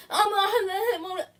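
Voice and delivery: high, babbling mock cry; hammed-up incoherent wail. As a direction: imitate her in a high, blubbering whine. Mocking Sarah's incoherent upset reply.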